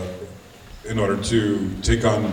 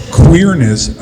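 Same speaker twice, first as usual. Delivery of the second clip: sudden burst, higher and louder; 5 semitones higher, 14 dB louder than usual. Real, not acted. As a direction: blurt the first word loudly. Opens answer with sudden loud word.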